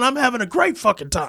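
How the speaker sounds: high-pitched, hammed-up gamer rant; mock yelling. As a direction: act out hollering defiantly mid-game. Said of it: "I'm yelling when I'm playing".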